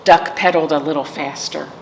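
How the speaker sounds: ordinary delivery, nothing marked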